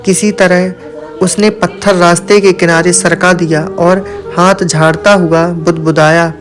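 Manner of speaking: plain reading voice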